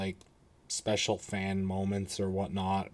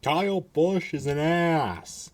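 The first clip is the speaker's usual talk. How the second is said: put-on higher, drawn-out Michigan-accented voice; slow mock Michigan accent, pitched up; Brad Keselowski; 6 semitones higher, slower than usual. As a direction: do a stretched-out Michigan impression. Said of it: "in his basic Michigan voice".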